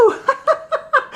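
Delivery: high, rising burst of laughter. Acted. The elephant laughs at his cured sneeze. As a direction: laugh out in relief. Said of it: "laughed the elephant".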